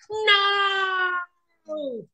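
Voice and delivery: high anguished shout; despairing cry. Acted. Hare watching Tortoise win, anguished shout. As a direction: howl in dismay.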